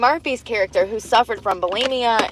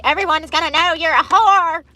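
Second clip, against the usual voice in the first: mock angry old hag; 8 semitones higher than usual. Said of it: "she's like".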